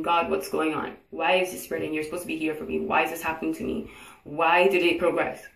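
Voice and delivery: normal talking voice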